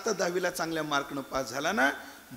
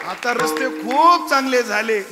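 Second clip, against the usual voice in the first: louder and slower, leaning hard on खूप; emphatic slow declaration; 4 semitones higher, 9 dB louder, slower than usual. Acted. Preacher booming the line at the audience. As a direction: drive each word to the crowd. Preacher hammers the point home.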